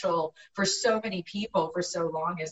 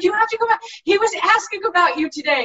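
high, loud insistent pleading; urgent fan; 11 semitones higher, 7 dB louder, faster than usual. Retold or imitated. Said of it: "she's like".

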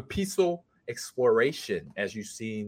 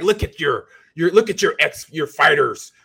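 louder, gloating Emperor taunt; imitating the Emperor; 4 semitones higher, 8 dB louder than usual. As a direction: needle Luke about his losing fighters. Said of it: "He's like".

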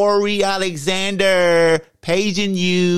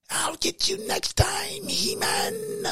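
high, hushed villain threat; evil villain threat; 9 dB quieter, slower than usual. Shown as a demonstration. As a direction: snarl the villain's parting threat. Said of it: "This is my evil voice".

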